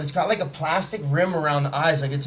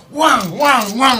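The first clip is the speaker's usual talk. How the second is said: mock punch noises; 8 semitones higher, 6 dB louder, much slower than usual. Mimicking his own punches.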